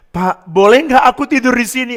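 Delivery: high, loud acted request; pleading neighbour, imitated. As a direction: beg like the troubled neighbour, voice climbing. Neighbour begs to stay.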